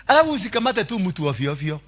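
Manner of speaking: ordinary talk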